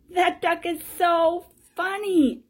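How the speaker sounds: loud, high-pitched put-on exclamation; amused onlooker. Another onlooker laughing at duck.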